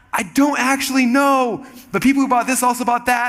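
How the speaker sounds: high-pitched, defensive excuse; acted-out sheepishness, held high; sheepish admission. Acting his sheepish answer to Dan.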